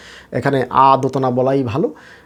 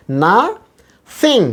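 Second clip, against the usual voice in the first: Nothing slow and high, first syllable stressed and apart; stress at the start; 7 semitones higher, much slower than usual. Shown as a demonstration. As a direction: stretch nothing into "না", pause, "থিং". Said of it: "এখানে শুরুতে stress আছে". (নাথিং-এর শুরুতে জোর দিয়ে টেনে)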